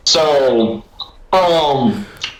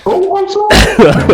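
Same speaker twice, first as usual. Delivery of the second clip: cooing apology to a pet; 5 dB louder than usual. Real, not acted. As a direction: owner fussing over the cat she just hurt. Apologizing to cat after door.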